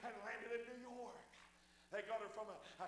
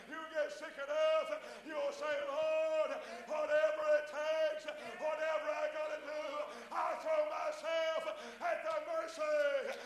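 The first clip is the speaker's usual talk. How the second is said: louder, higher shouted preaching; preaching at full force; 4 semitones higher, 7 dB louder than usual. Acted. Preacher pleading with the church, shouting. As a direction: cry it out to the rafters.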